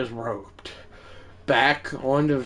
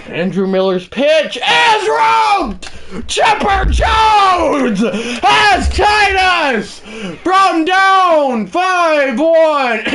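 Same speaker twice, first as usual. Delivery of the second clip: much higher, slow, loud broadcaster scream; screaming like an overexcited toddler; screaming announcer call; 13 semitones higher, 6 dB louder, much slower than usual. Acted. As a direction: scream the comeback like a play-by-play man. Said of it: "I should not be screaming as loud as I am".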